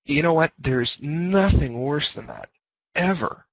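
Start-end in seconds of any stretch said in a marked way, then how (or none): none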